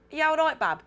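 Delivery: Birmingham accent greeting; Brummie accent. Shown as a demonstration. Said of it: "can you do a Brummie accent".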